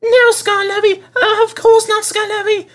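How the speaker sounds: very high, meek quavering coaches. A narrator's reading. Cowed coaches answering stern Skarloey, meek quaver. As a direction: quaver it meekly. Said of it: "quavered the coaches meekly".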